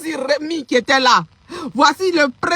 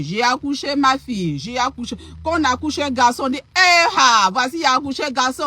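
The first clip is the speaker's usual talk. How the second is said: ordinary talk, nothing marked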